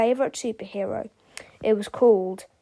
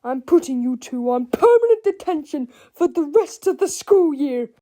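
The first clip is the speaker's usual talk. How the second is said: high, loud angry sentencing; Mister Krupp; 5 semitones higher, 6 dB louder than usual. A narrator's reading. Krupp hands the boys detention, shouting. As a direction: shout the punishment at them. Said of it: "Mister Krupp shouted".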